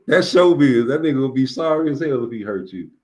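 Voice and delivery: ordinary talk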